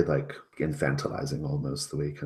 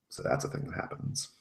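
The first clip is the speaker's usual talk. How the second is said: ordinary talk, nothing marked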